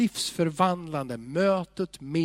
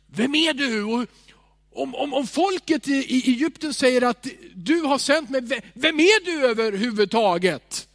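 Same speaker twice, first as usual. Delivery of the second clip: anxious Moses; 7 semitones higher, 6 dB louder than usual. Retold or imitated. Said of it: "Han säger".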